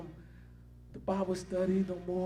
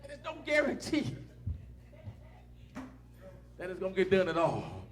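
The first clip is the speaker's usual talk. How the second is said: higher, louder preached complaint, slowed on pay; preaching at full force; 5 semitones higher, 3 dB louder than usual. Acted. Preacher rebuking church folks who demand payment, in full preaching force. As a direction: call out the church folks from the pulpit.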